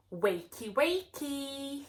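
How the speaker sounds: loud, rising villain taunt; sing-song loud taunt; evil pea calling. Acted. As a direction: call out to wake them, mocking. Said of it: "he called".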